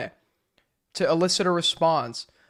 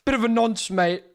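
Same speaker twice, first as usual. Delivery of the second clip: lightly voiced mate-heavy British commentator; a touch of London bloke, jokey; mock British commentator; 3 semitones higher than usual. Mocking old commentary drama, a light British bloke voice. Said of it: "flashbacks to old commentary".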